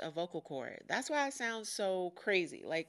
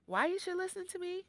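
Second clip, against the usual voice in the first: dramatic rhetorical question; 12 semitones higher than usual. Acted. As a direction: pose it like a game-show reveal. Rhetorical pitch to listeners.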